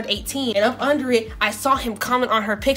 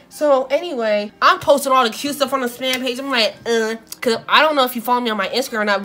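ordinary delivery

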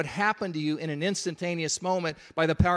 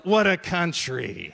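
slow, drawn-out Russian comedian catchphrase; Yakov Smirnoff; 3 dB louder, slower than usual. Retold or imitated. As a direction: deliver the punchline slowly, mock Russian comedian. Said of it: "that Russian comedian Yakov Smirnoff".

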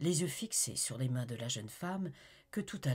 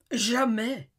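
loud, high outcry; exclaim; 10 semitones higher, 10 dB louder than usual. A narrator's reading. Olivier refusing to abandon Amy, loud outcry. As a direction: fling the word at her. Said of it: "s'écria-t-il".

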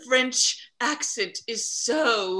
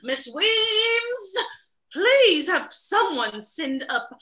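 calling out; 9 semitones higher, 4 dB louder, slower than usual. Acted. Calling out to servant.

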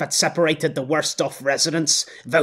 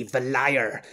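ordinary delivery

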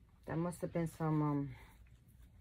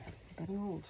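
quiet aside; 10 dB quieter than usual. Real, not acted. Muttered aside about getting old.

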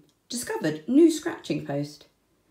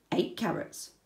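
usual speaking voice

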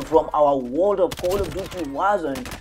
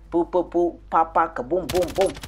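gunfire sounds; 2 semitones higher than usual. Retold or imitated. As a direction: mouth gunfire, poo poo boom. Imitating gunfire.

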